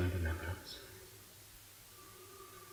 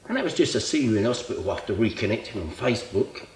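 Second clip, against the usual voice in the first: louder, indignant rant; exasperated protest; 3 semitones higher, 7 dB louder than usual. Acted. Old friend asking why he was called at all, exasperated. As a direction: push back hard at an unwanted demand.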